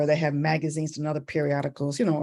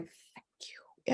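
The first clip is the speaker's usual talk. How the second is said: breath-only grateful whisper; hushed thank-you; 14 dB quieter than usual. Retold or imitated. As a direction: whisper it privately, relieved. Mother's quiet thanks.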